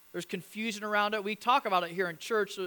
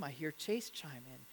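ordinary talk, nothing marked

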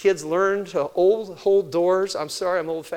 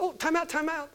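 high sing-song "time out, time out"; calling time out; 11 semitones higher than usual. Retold or imitated. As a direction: pitch it up, playful and firm. Grandpa stopping kids fighting.

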